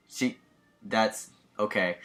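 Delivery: ordinary talk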